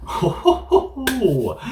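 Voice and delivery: very high hearty ho ho ho; hammed-up Santa laugh; jolly laugh. Laugh before next story.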